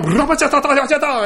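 high, drawn-out rolled mock babble; imitation speaking in tongues. Disciple suddenly starts speaking in tongues, mock babble. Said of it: "do you ever talk like that".

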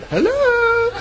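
very high, drawn-out phone greeting; mock Mister Nice Guy, stretched and high; fake nice. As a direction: put on an exaggeratedly sweet hello. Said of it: "How did you become Mister Nice Guy?".

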